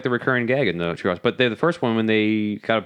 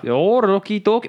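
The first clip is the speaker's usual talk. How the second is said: higher-pitched, botched Scottish accent; bad Scottish accent; 7 semitones higher than usual. Shown as a demonstration. Asked to do the accent, badly faked Scottish. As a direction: attempt Scottish and miss. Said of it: "I don't do my Scottish accent".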